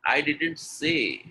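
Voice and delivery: slow, stretched "say"; drawn-out emphasis on "say"; stress on say. Shown as a demonstration. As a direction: hold "say" long. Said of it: "when I'm stressing on say".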